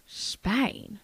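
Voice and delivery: soft, mumbled Southern "Spain?". Retold or imitated. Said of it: "I knew a redneck once".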